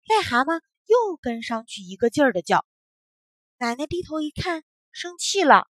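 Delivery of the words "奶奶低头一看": ordinary delivery, nothing marked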